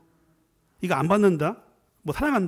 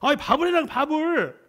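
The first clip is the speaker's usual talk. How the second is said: demanding husband; 8 semitones higher than usual. Acted.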